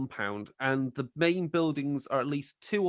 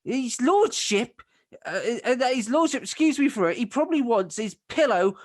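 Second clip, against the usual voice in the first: higher, louder fed-up husband; exasperated farmer; 9 semitones higher, 5 dB louder than usual. Acted. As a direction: snap back at the nagging wife. Husband snaps at wife.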